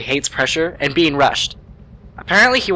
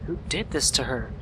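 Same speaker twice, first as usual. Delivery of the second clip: soft, broken question; hushed grief; 10 dB quieter than usual. Acted. Mechanic asking about Paula's killer, hushed and grieving. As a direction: ask it barely holding together.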